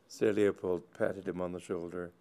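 ordinary delivery

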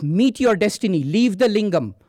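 high, loud command; commanding god of death. A narrator's reading. Yama ordering the boy off the lingam, loud command.

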